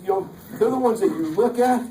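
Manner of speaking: ordinary delivery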